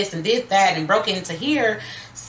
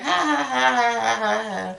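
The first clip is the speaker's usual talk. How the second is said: spelled-out "ha ha" cackle, starting high and falling; mock hysterical laugh; 3 semitones higher than usual. Imitating Mary laughing behind the door, mock hysterics. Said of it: "Mary laughing loud, hysterically".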